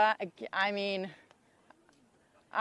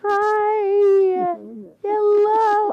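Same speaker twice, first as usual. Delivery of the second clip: much higher, louder, crying with joy; tearful joy; 9 semitones higher, 13 dB louder, slower than usual. Real, not acted. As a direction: sob out the greeting while hugging. Emotional first meeting, tearful hug.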